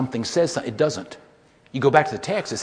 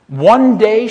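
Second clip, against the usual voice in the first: loud reading; 7 semitones higher, 13 dB louder than usual. Retold or imitated. Lady reads the verse aloud.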